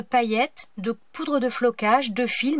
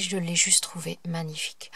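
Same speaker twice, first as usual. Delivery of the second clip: chuchoté; 6 semitones lower, 6 dB quieter than usual. Real, not acted. Admire le cahier, en chuchotant.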